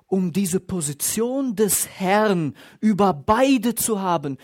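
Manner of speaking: loud, rising sermon climax, pressing each phrase; fervent preaching. Acted. Pastor driving his point home to the congregation, loud fervent preaching. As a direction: preach it out to the whole church.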